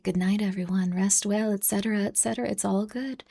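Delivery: imitating a sleepy sign-off in a whisper. Streamer imitating a guest whispering good night, soft mock whisper. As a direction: breathe it out like tucking chat in. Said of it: "if you were like".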